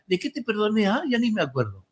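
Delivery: fingir no acordarse, algo más suave; un desmemoriado de broma, sin exagerar; olvido fingido. Retold or imitated. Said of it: "porque olvidarse le decía". (fingiendo olvido con suavidad)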